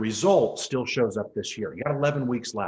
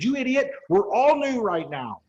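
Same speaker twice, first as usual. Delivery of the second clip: raised, exasperated snap at a caller; retold outburst; 9 semitones higher than usual. Losing patience with a webinar caller, raised exasperated retort. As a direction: lose patience and let it rip, pitched up. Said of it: "And I freaking lost it".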